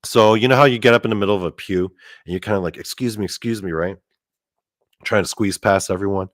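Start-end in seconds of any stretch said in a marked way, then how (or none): 2.8-3.7 polite murmur. Retold or imitated. Squeezing past people in pew.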